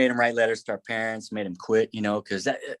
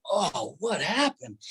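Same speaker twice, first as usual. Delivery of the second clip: dazed; 5 semitones higher, 3 dB quieter, slower than usual. Retold or imitated. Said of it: "I'm like".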